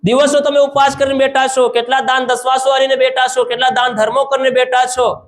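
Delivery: high-pitched, sustained exhortation; forceful preaching. Real, not acted. Preacher telling believers their fasts and donations do not count, forceful preaching.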